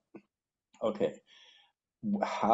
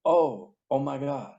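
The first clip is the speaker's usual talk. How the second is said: amazed realization; 6 semitones higher than usual. Retold or imitated. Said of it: "his eyes lit up and he goes".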